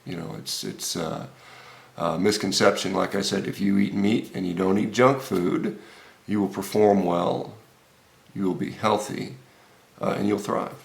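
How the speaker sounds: normal talking voice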